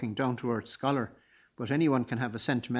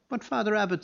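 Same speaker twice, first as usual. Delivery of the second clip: pleading; 9 semitones higher than usual. A narrator's reading. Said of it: "said poor Ultan".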